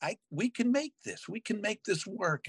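high, pumped-up self-encouragement; rally yourself, high and eager; determined high voice. Retold or imitated. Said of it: "And I'm like".

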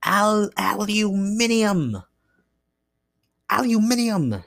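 exaggerated British "aluminium"; playful British pronunciation. Shown as a demonstration. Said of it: "That's just fun to say".

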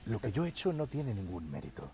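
low, soft, self-important imitation; pedantic politician. Politician answering the radio interviewer, mock pedantic. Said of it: "con esta voz de pedante".

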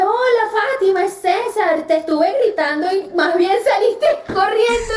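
high, lilting retold phone hello; animated caller greeting. Doctor phones the woman who ran away, animated greeting.

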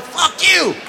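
high, loud furious yell at a golf buddy; shrill-free mock rage, loud and high; angry yell. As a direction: snap it like a sore loser. Cutthroat golf buddies yelling.

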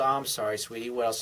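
put-on soft, low comforting boyfriend act; tender murmured apology, play-acted; mock soothing. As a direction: imitate consoling a tearful sweetheart, voice dropping. Playing boyfriend comforting crying girlfriend.

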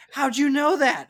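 high, loud surprised mom voice; imitating mom. Mom amazed her son knows, high and loud. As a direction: squeal it like a startled mom. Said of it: "And she goes".

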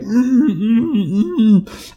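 high, strained hums, mouth shut tight; muffled scream. Retold or imitated. Groom with stitched mouth screaming at the altar, high muffled hums. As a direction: try to scream but only hum. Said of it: "I just screamed there".

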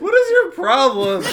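shouted through laughter; cracking up, loud and high; laughing. Real, not acted. Laughing at friend's coffee spill.

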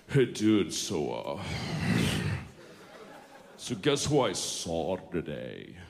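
low, slow, slack dude mumble; lightly voiced lazy guy. Comic mimicking a guy telling his friend news, low and slack. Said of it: "The one guy says".

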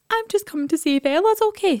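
put-on a high, sweet little boy voice; innocent little boy. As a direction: play the innocent child with a pitch far above your own. Son's innocent excuse voiced.

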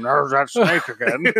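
slow put-on voice, lightly done; John Voight. Brother imitates John Voight lurking in the background, slow put-on voice. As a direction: drawl it slowly like a creepy character actor. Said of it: "John Voight in the background going".

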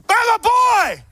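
high, full-volume proud holler; rowdy cheering dad. Acted. A dad at the school assembly cheering his kid, proud shout. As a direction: bellow it over the whole room. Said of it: "Just like that".

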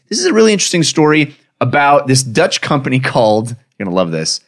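ordinary talk

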